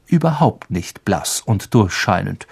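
ordinary delivery, nothing marked

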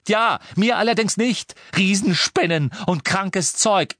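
high, fast, furious tirade; angry ranting. A narrator's reading. Ron raging at Harry about his sister, furious rant. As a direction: rage at your friend, words rushing out.

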